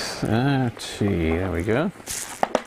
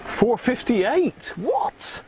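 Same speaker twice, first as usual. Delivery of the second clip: high, slow, stunned disbelief; can't believe the number; surprised; 11 semitones higher, much slower than usual. Real, not acted. Meter shows an unexpected reading.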